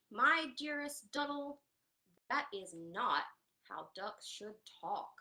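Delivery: a hint of a prim, loud goose; lightly voiced fussy goose scolding; mild goose reproach. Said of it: "She honked".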